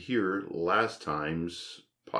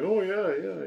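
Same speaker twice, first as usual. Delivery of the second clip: high squeaky reply; 13 semitones higher than usual. Pretend.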